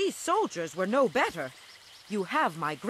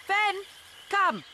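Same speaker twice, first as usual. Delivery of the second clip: calling out; 11 semitones higher than usual. Acted. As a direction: call the ox over from across the camp. Calling the ox from afar.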